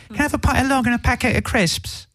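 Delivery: high-pitched attempt at a British accent; badly faked British accent. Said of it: "he did teach me how to say".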